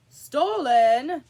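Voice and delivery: loud, high exclaiming king; king exclaims to the jury; exclaimed. A narrator's reading. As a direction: cry out the accusation. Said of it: "the king exclaimed".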